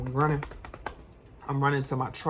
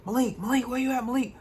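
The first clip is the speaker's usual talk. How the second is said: calling out for brother; 10 semitones higher than usual. Retold or imitated. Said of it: "I'm yelling my brother name".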